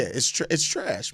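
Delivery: ordinary delivery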